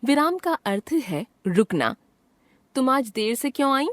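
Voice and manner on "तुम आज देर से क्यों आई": higher-pitched question, as in a lesson example. Shown as a demonstration. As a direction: ask it as a clear textbook question. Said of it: "प्रश्न पूछने". (सवाल पूछने का लहजा)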